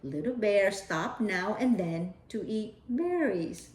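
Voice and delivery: usual speaking voice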